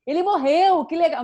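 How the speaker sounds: empolgação exagerada, aguda e alta; dê a má notícia como se fosse ótima; animação inadequada. Shown as a demonstration. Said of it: "Eu não vou falar de algo assim". (alegria fora de hora, encenada)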